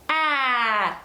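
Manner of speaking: a clear open "ah" held briefly; modelling the vowel "ah" for a toddler; ah vowel demo. Shown as a demonstration. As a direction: say "ah" slowly for the baby to copy. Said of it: "and you say".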